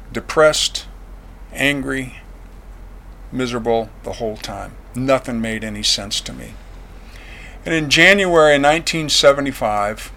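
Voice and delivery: ordinary talk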